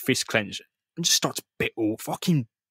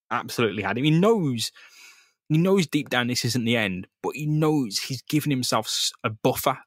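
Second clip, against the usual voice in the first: ordinary delivery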